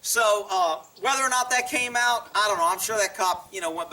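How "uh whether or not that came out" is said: ordinary talk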